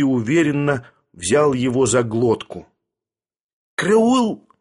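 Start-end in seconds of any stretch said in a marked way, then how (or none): 3.8-4.5 high, sudden squeal of alarm; frightened squeal. A narrator's reading. Sharikov grabbed by the throat, frightened squeal. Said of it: "пискнул Шариков бледнее".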